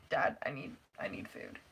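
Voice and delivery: faint, breathless begging, trailing to a whisper; drained sick-kid whisper; weak plea. Retold or imitated. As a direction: sound too sick to get the words out. Weak plea to dad while sick.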